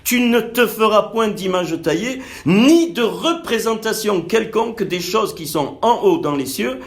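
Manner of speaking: plus haut, lent et fort, proclamé; prédication fervente. Acted. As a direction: proclamer la Loi avec ferveur. Lit Exode vingt en prêchant. (lecture prêchée, plus aiguë, lente et forte)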